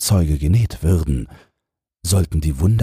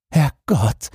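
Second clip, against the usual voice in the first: put-on startled high old man's gasp of a word; a flustered old minister blurting in shock; high, alarmed minister voice; 10 semitones higher than usual.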